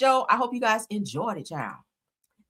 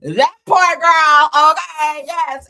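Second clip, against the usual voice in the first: excited; 9 semitones higher, 12 dB louder, much slower than usual. Real, not acted. Reacting to a funny meme.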